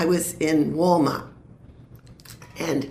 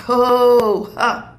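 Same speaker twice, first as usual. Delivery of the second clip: ordinary talk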